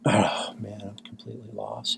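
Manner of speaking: despairing whisper to himself. Real, not acted. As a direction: breathe it out in defeat. Muttered despair at losing.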